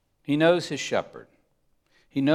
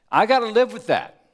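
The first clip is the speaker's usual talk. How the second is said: high and loud, playfully resigned; mock groan outcry; 9 semitones higher, 7 dB louder than usual. Real, not acted. Preacher admits he is a sheep, loud and high. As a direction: wail it like a reluctant sheep.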